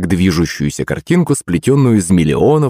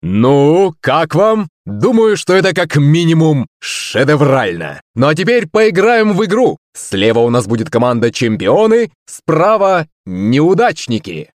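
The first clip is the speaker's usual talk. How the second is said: high-pitched, rousing stage presenter; excited show host pitching up to the hall; showman host; 9 semitones higher than usual. Acted. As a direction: work the crowd like a circus master of ceremonies. Host addresses the audience.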